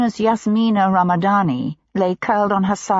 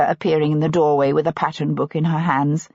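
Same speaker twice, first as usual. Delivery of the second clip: plain reading voice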